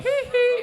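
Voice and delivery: high falsetto hee hee. Retold or imitated. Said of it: "when he would go".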